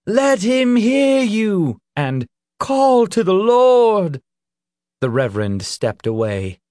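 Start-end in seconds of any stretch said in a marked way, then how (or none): 0.0-1.8 high, loud, drawn-out cry; crowd shout. A narrator's reading. Crowd follows the reverend, shouting to the children. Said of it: "shouts of". 2.6-4.2 high, slow, full-voiced cry; crowd shout. A narrator's reading. Crowd urges the children on, shouting loudly. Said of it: "shouts of".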